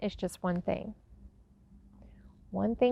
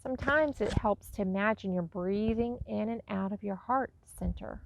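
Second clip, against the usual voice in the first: guided meditation; 5 dB quieter than usual. Acted. Teacher guiding listeners to breathe love in and out, soft guided meditation. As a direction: ease them into each breath, softly and unhurriedly.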